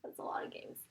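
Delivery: soft whispered aside. Real, not acted. Teen aside about the games, whispered.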